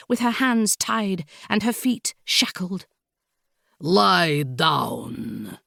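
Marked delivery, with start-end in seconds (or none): 3.8-5.6 low, drawn-out foreign villain order; commanding Count. A narrator's reading. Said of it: "the Count commanded".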